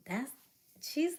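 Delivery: usual speaking voice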